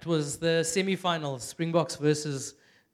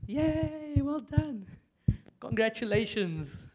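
half-hearted mock cheer; 4 semitones higher, 4 dB quieter, slower than usual. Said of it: "Or are we like".

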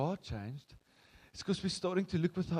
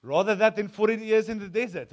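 defiant retort; 4 semitones higher, 9 dB louder than usual. Retold or imitated. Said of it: "And they're like".

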